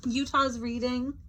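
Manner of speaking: plain reading voice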